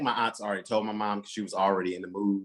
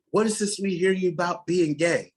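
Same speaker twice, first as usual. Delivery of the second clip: aunts' accusing voice; 8 semitones higher, 4 dB louder than usual. Retold or imitated. Aunts calling on five-way phone.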